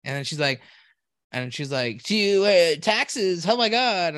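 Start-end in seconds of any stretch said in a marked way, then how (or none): 2.0-4.1 high, louder bubbly gush; imitating the barista gushing about taxes; SoCal barista voice. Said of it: "she sounds very SoCal".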